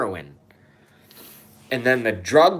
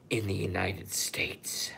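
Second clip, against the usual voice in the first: whisper; 3 semitones lower, 10 dB quieter than usual. Acted. Ranter whispers the big secret to viewers. Conspiracy line dropped to whisper.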